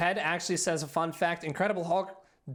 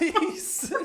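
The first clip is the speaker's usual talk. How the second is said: ordinary talk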